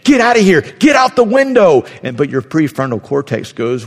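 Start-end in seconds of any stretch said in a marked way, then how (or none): 0.0-1.8 high, loud frantic shout; the amygdala bellows an escape order; panicked shout. Pretend. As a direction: yell to flee the house. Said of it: "It's like".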